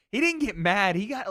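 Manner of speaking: ordinary delivery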